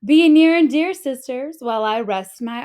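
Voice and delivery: calling out to sisters from a hammock. A narrator's reading. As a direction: call out to the sisters before resting. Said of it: "called out".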